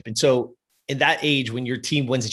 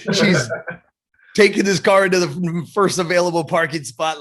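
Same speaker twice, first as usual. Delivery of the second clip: laughing; 5 semitones higher, 5 dB louder than usual. Real, not acted. Laughing while retelling mom's reaction.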